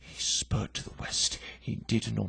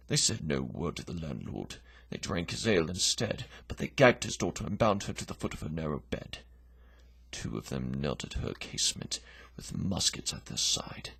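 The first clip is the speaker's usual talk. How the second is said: ordinary delivery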